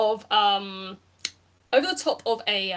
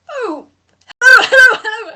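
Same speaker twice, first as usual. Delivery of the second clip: high, bursting hello, much louder; gushing greeting; 11 semitones higher, 13 dB louder than usual. Acted. Flower creature greeting a famous guest, high and excited. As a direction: gush out a delighted hello.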